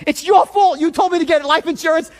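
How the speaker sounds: high, loud accusation; angry blaming. Retold or imitated. Man blaming his aunt for the insurance call, angry. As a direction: lash out in a retold row. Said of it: "I wanna be angry and pissed off".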